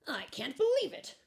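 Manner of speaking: high, loud delighted cry; excited cry. A narrator's reading. Lump of coal thrilled by the gallery, crying out in excitement. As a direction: burst out in amazement. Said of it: "cried the lump of coal".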